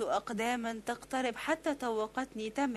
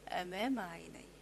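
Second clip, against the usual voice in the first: تأكيد خافت; 4 semitones lower, 9 dB quieter than usual. Acted. الجواب المؤكد بعد السؤال.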